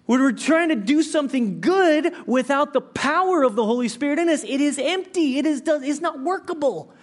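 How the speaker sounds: loud, high preaching climax; preaching at full force. Acted. Pastor preaching loudly to his church. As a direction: drive it home to the congregation.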